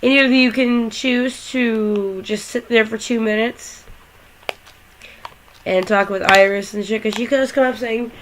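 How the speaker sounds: ordinary talk, nothing marked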